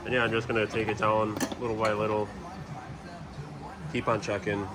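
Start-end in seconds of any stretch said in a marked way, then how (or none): none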